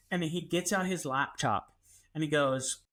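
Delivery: ordinary talk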